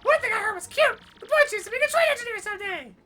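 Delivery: very high, fast squeaky voice; chipmunk-high, loud and quick. Acted. Voicing a high squeaky character.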